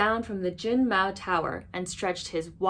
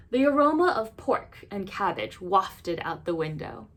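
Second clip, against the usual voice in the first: plain reading voice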